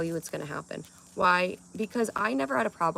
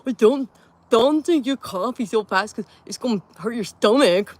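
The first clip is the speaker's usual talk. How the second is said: mocking ex; 5 semitones higher, 9 dB louder than usual. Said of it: "do it sound exactly like him".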